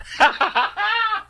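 high, real burst of laughter. Real, not acted. Host cracking up at his own bad impersonation, real laughter.

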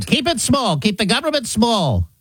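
small, high put-on voice; high mock scolding in a diminutive voice; James Madison. Founder ordering posterity to keep government small, little high voice. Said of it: "like James Madison impersonation".

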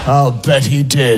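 1 mock quiz voice. Comic mock game answers.

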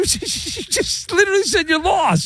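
high, laughing disbelief; incredulous. Real, not acted. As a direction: squeal it in amused disbelief. Incredulous at caller's own words.

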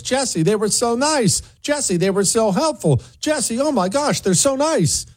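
breathless praise pitched high and bright with glee; raving customer. Retold or imitated. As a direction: gush like a delighted customer. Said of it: "somebody raving about how nice customer service was".

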